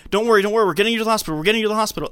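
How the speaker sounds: calm her while panicking yourself; frantic driver soothing a passenger; mock panicked reassurance. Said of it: "just saying, like".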